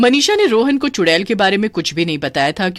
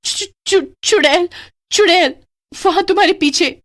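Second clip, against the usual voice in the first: high stammering panic; terrified; 10 semitones higher than usual. Acted. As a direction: stutter it out in terror. Terrified at seeing witch. (डर से हकलाती आवाज़)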